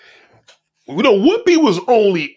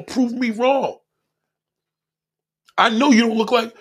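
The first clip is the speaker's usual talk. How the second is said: normal talking voice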